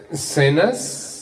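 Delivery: sting him with one sharp word; seething wife. Retold or imitated. Fuming wife greets husband back from AA, sarcastic. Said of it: "ella está como agua pa' chocolate".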